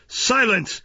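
put-on the old gentleman silences his housekeeper, staged anger; feigned angry shout. A narrator's reading. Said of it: "feigning an anger he was far from feeling".

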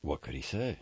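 ordinary delivery, nothing marked

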